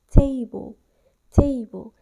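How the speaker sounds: "Table" with the first syllable hit loud; "table" said TA-ble, strongly stressed; exaggerated stress on TA. Shown as a demonstration. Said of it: "exaggerate the T syllable and add even more emphasis".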